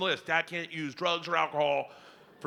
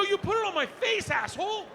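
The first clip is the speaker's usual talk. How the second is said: much higher indignant yell; outraged yelp. Retold or imitated. Dad outraged at daughter, indignant yelp. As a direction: squeal in disgust.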